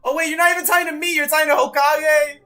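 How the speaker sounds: high, loud, fast outburst; mock-outraged shout. Streamer realising the fan meant Hokage, mock-outraged shout.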